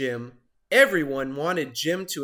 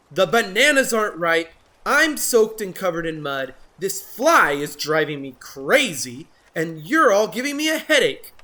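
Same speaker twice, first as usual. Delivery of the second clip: higher, louder exasperated rant; angry rant; 5 semitones higher, 7 dB louder than usual. Acted. Jim ranting at his friends, exasperated. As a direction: complain in rising frustration.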